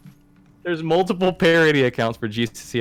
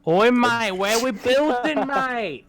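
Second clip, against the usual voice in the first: high, hammed-up Australian accent; mock Australian accent; 9 semitones higher, slower than usual. Mocking Australians for laughs with a high Australian accent. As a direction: ham up a drunk Aussie bloke. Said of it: "just my Australian accent now".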